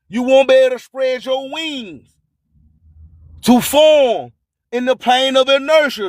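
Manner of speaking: ordinary talk, nothing marked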